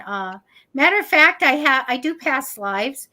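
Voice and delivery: ordinary delivery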